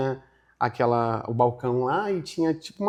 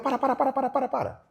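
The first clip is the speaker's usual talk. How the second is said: high, rapid string of cut-ins; exasperated high-pitched repeated plea to stop; fed-up interruption; 9 semitones higher than usual. Retold or imitated. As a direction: imitate someone who can't take any more. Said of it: "ele não aguentou".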